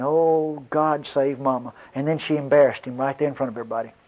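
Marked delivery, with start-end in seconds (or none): 0.0-1.7 soft, low retold prayer; praying. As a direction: quietly voice a son's slow plea. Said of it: "He'd been praying".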